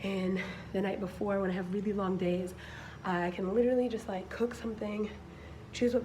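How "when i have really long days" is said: normal talking voice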